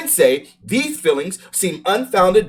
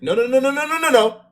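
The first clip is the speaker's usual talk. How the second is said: rapid string of rising noes, much higher; emphatic refusal; 7 semitones higher than usual. Retold or imitated. Retelling how he refused the counselor's apology, emphatic high noes. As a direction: shut the apology down, no no no. Rejecting an apology in a retold argument.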